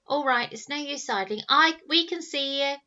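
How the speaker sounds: loud, high call to the bushes. Acted. Eldest boy calling out a bluff to the hidden watcher. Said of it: "called the eldest".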